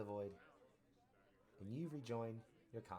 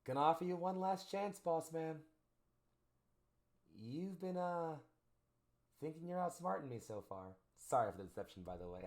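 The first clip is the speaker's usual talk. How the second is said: high, loud figure making a last offer; high-voiced trickster confronting an opponent; scheming deceiver; 9 semitones higher, 6 dB louder than usual. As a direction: pitch it up, taunting the boss man. Game master voices deceiving figure.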